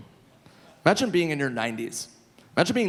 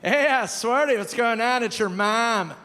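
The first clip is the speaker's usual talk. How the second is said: [name]'s voice, higher, saying it's your mom; 7 semitones higher than usual. Retold or imitated. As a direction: do [name] calling as your mother. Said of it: "hear, like".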